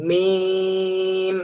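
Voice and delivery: মিম held long, lips closed at the start and at the end; drawn-out meem. Shown as a demonstration. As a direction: model মিম slowly for the class. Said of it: "সবাই বলি". (টেনে মিম উচ্চারণ)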